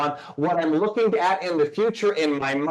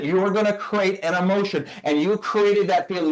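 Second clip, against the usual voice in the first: ordinary talk, nothing marked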